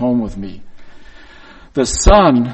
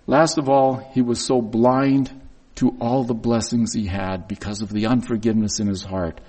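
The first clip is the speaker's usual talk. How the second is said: ordinary talk, nothing marked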